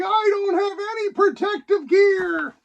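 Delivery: hammed-up crying panic in space; panicked squeal. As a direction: squeak it out in cartoon terror. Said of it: "He's like".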